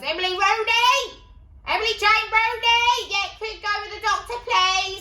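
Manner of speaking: high, loud shout of the name across the room; receptionist calling out. Retold or imitated. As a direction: yell it like a frantic receptionist. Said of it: "And she goes".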